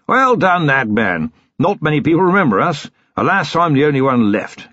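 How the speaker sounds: put-on cheerful old military man holding forth; bluff old colonel. A narrator's reading. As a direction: play a jovial retired colonel reminiscing. Old colonel chatting at dinner.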